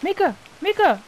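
calling out. Real, not acted. Calling out to his friend.

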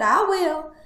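high piglet voice, coming down; concede, then slip in a condition; reluctant little piglet; sighing resignation. Acted. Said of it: "sighed the piglet".

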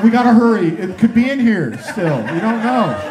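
loud, high, panicky urging; hammed-up fear of a lurking monster; frantic half-laughing panic; mock panic. Panicked rushing out of bathroom.

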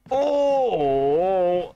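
high drawn-out zombie groan; zombie moan. Shown as a demonstration. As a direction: moan like a Resident Evil zombie. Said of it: "something like".

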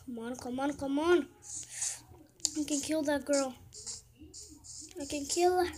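ordinary talk

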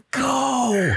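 high, urging call; tempting conscience. Acted. GM as conscience pushing the player upstairs, high urging. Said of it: "almost like your conscience telling you".

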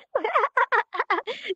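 a bright high burst of laughter. Real, not acted. Teacher laughing after hamming up Texas, high laugh. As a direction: laugh at your own hamming.

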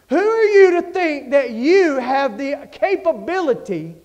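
loud, high preacher's thunder; full-force preaching. Acted. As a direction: hammer it at the congregation.

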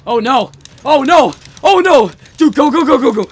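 rising terrified yells; panicked screaming. Real, not acted. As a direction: scream as she closes in. Chased by enemy.

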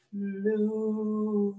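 put-on playful moo for the kids; low it like a friendly cow; lightly voiced cow; mock cow moo. Cow pose animal sound.

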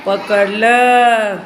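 slow playful pounce, laughing; drawn-out grab with a laugh. Acted. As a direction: stretch the word out slowly, laughing. Lion grabs the mouse.

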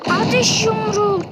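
high, loud shouted spell name. Real, not acted. Gamer casting fire punch in a fight, loud high shout. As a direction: call out the fire punch like an attack.

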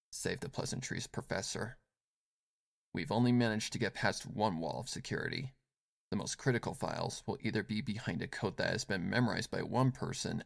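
low, soft, brisk woman voice; dry and businesslike; curt cool woman. Acted. Yelan curt in dialogue.

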